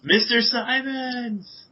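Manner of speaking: high, drawn-out calling shout; screamed call. Acted. Lily searches the brambles for Mister Simons, screaming out. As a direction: call out for a missing man, stretching the name. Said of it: "I scream out".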